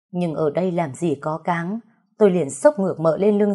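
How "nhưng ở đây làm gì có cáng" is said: ordinary delivery, nothing marked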